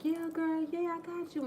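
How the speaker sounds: high friendly neighbor voice; neighbor girl. Retold or imitated. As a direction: answer like an eager friend. Neighbor agrees.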